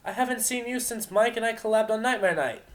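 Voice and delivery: high, loud delighted greeting; excited Glaze. Acted. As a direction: greet an old friend with delight.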